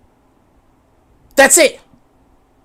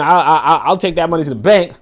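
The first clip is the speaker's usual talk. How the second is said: ordinary talk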